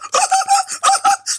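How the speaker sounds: very high mock giggle. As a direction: mimic his giggling in a high put-on voice. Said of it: "Now he's got the giggles".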